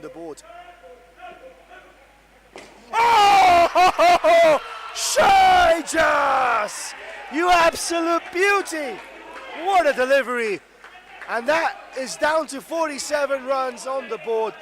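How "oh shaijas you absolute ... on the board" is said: roaring, high-pitched celebration of a wicket; ecstatic commentator's shout. Real, not acted. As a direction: shout it loud and high, drawing out the big moments.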